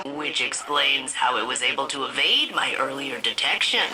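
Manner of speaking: usual speaking voice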